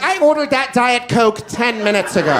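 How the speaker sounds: a hint of an entitled customer complaining; lightly voiced irritated diner; angry restaurant lady. To a waiter, a lightly voiced entitled complaint. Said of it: "Like I'm an angry lady at a restaurant".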